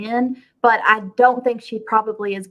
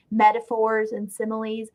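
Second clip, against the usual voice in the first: normal talking voice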